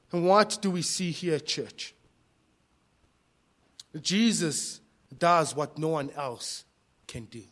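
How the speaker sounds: ordinary talk, nothing marked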